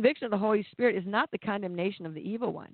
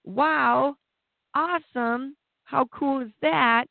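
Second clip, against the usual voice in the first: high, mock-delighted cheering; mock celebration the Pharisees never gave; sarcastic mock cheer; 6 semitones higher, slower than usual. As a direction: gush sarcastically like a thrilled fan. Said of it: "none of the Pharisees are rejoicing".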